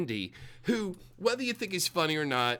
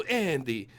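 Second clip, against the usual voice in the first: ordinary delivery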